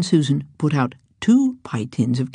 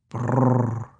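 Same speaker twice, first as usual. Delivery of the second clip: cat purr; 4 semitones lower than usual. A narrator's reading. Cat purrs.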